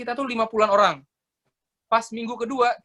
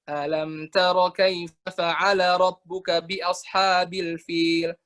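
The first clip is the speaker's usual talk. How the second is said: very slow chanted recitation; Quran recitation; much slower than usual. Shown as a demonstration. Teacher reciting Al-Fil slowly to show intonation. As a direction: draw the verse out in tartil. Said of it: "Kalau misalnya kalian baca".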